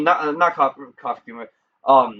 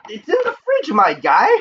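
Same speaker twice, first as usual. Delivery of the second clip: squeaky voice; 11 semitones higher than usual. Real, not acted. Mock squeaky reply to chat.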